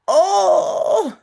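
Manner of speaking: high wailing oh, hammed up for the story; mock crying oh. A narrator's reading. Teacher reading the story, voicing a dismayed cry. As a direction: wail it like a child reading to kids. Father cries over broken toy.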